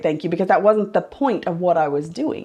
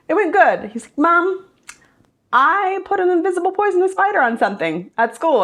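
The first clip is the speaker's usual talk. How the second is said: put-on child's voice; 7 semitones higher than usual. Son's voice in retold story.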